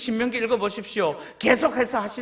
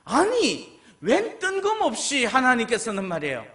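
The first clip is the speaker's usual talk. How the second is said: ordinary talk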